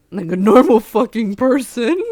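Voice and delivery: loud, exasperated outburst; exasperated yell. Real, not acted. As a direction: shout it in disbelief.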